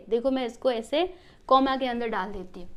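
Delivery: usual speaking voice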